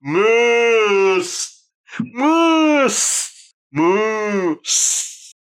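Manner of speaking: high, loud zombie wails while flying; zombie cries. Acted. Zombies flung through the air, high wailing cries. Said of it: "zombies go flying through the air and go".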